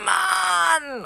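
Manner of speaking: put-on high drawn-out child's yell of frustration; frustrated child's cry. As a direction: wail it in helpless rage. Verzweifeltes Kind. (verzweifelter Kinderschrei)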